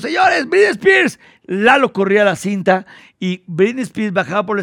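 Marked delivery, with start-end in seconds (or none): 0.0-1.2 mock showbiz announcement, much higher and slower; TV host announcing. TV host introducing [name] on air, higher announcer call. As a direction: hype the star's entrance like a TV host. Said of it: "decíamos".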